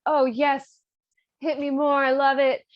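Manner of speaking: high, loud, hammed-up relish; mock delight. Figaro enjoys Susanna hitting him, mock delight. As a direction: egg her on with gleeful mock pleasure.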